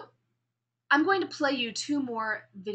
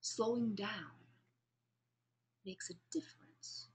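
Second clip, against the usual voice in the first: slow near-whisper; slow and hushed; 11 dB quieter than usual. Shown as a demonstration. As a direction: drop to a hush to show emphasis. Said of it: "I don't want to speak this slowly".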